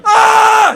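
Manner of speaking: loud high yelp of being hit; a mock pained scream; car hit scream. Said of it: "know that sound you make?".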